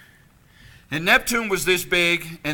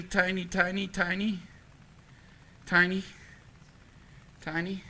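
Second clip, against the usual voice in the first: each tiny softer until almost gone; a preacher makes the congregation feel small, fading softer; fading to a whisper; 2 semitones higher, 10 dB quieter than usual. Acted. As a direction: let the word shrink away to nothing. Repeating tiny, fading away.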